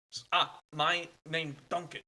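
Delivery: a hint of a halting German traveler asking directions; polite stranger searching for words; lightly voiced foreign traveler. NPC introduces himself.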